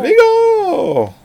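high, slow drawn-out cry; dramatic cry. Pretend. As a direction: cry out the name in awe. Dramatic cry of actor's name.

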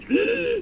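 sharp wheezing inhale; gasping breath. Retold or imitated. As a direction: imitate a strangled gasp for air. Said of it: "you can hear her breathing".

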